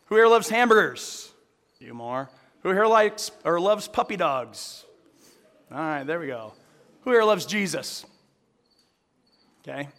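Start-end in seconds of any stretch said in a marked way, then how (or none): 0.1-8.1 calling out. Real, not acted. Asking the congregation.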